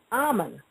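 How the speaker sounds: AH-mun, stress on the first syllable; first vowel of Ammon as in father. Shown as a demonstration. Variant pronunciations of one god's name.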